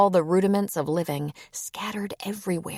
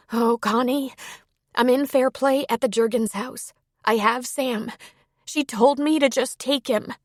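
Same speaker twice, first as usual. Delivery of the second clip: higher-pitched, earnest phone voice; emotional Sierra; 7 semitones higher than usual. A narrator's reading. Sierra phones Connie to say she has Sam, emotional. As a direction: lift the pitch and break the news.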